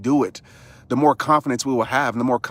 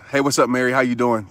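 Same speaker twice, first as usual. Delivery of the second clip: usual speaking voice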